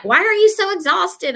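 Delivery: high, loud imitation of a puzzled husband; exaggerated questioning husband impression; mock incredulous husband. Husband voiced in her retold story.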